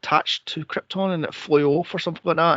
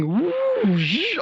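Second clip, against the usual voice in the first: high, squealing mock delight, much higher; mock girly squeal; 12 semitones higher than usual. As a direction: squeal it like a giddy girl playing with a toy.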